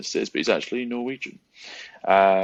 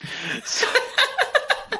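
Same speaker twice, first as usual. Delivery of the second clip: laugh. Real, not acted. Host laughs at cohost's answer.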